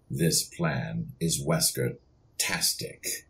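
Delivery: low, slow, menacing villain line; deep slow villain delivery, savoring Wesker-tastic. Shown as a demonstration. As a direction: purr it like a smug mastermind. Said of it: "wants to know if you can say something for her".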